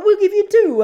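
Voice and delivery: very high, loud laughing Italian waiter; hammed-up Italian host, very high and loud; spruiker. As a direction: shriek the offer, laughing. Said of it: "I go".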